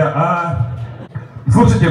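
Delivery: ordinary talk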